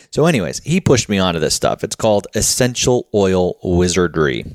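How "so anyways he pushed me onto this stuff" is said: ordinary talk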